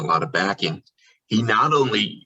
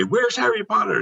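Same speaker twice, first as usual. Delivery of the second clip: lightly voiced eager guest, higher; a guest asking; 13 semitones higher than usual. A guest asks the wand keeper, higher eager question. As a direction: ask like a curious visitor. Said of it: "they say".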